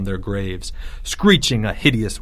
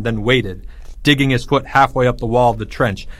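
usual speaking voice

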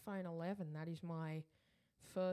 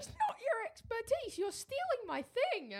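voice cracking; 4 dB louder than usual. Real, not acted. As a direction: voice cracking up high. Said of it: "you're making my voice crack".

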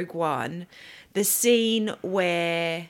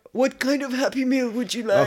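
lightly voiced movie character, slightly raised; half-successful film impression; attempted character impression; 4 semitones higher, 3 dB louder than usual. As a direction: have an amateur go at the voice. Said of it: "I can't do the voice".

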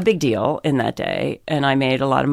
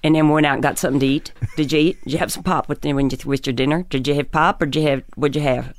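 Pennsylvania accent; faster than usual. Shown as a demonstration. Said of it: "You talk like that".

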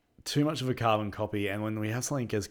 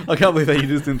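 laughing; 7 semitones higher, 12 dB louder, much faster than usual. Real, not acted. Teasing while laughing.

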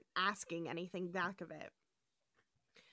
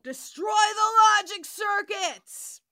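loud, high battle cry; triumphant shout; 10 semitones higher, 12 dB louder than usual. Pretend. Echoing the friend's line about beating the computer, shouted. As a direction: yell it like a rallying call.

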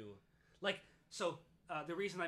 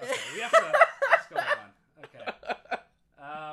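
laughing; 20 dB louder, slower than usual. Real, not acted. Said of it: "laughing fit".

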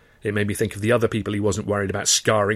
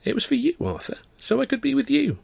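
put-on high, small girlish plea; a hint of a timid young woman. Girl pleading with her angry boyfriend, small voice. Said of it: "in a small voice".

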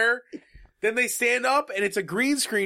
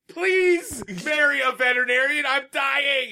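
whiny dog voice; 7 semitones higher than usual. Pretend. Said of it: "He's like".

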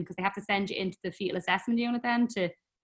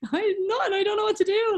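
much higher, flustered; mock flustered wail about the plan; laughing overwhelm; 14 semitones higher, 4 dB louder than usual. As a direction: play it lost and laughing. Retold overwhelm.

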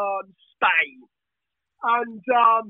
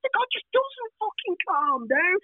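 mock disbelief; 10 semitones higher, 4 dB quieter than usual. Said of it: "he said".

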